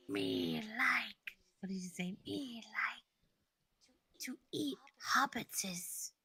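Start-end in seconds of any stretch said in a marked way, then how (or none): 0.1-1.4 hissing Gollum whisper; Schmeagol whisper. Acted. 2.2-6.1 hissing Gollum whisper; Schmeagol whisper. Acted.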